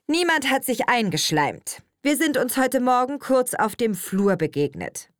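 stern and final; brooking no discussion. A narrator's reading. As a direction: shut down any further debate. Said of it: "in einem Tonfall, der jede weitere Diskussion verbot".

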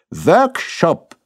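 Workshop snapped up very high in triumph; triumphant correction. Acted. Wife correcting her husband with triumph, high and smug. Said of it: "korrigierte Frau Hofmann triumphierend".

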